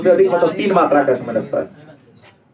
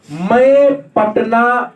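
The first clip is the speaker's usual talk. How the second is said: मैं Patna very slowly stretched out, higher; slow, raised, deliberate मैं Patna; emphatic example phrase; 4 semitones higher, much slower than usual. Shown as a demonstration. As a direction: draw out मैं and Patna for the class. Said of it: "इस वाक्य में जोर कोना पर".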